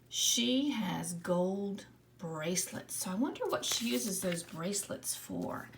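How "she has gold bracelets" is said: slow, word by word read-aloud. Shown as a demonstration. Teacher rereading the sentence slowly with the class. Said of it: "Let's read it again".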